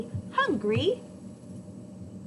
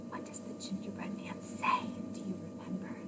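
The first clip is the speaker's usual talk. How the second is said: hushed question; 7 semitones lower, 10 dB quieter, faster than usual. Real, not acted. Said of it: "storyteller quizzing children".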